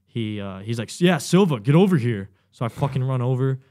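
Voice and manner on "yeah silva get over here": loud, pitched-up summons; drill instructor's call. Retold or imitated. Drill instructor summons recruit from the dark, loud call. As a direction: call a recruit over sharply.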